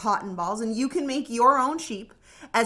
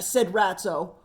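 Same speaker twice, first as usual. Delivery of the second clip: plain reading voice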